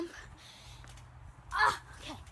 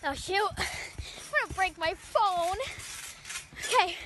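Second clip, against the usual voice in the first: ordinary talk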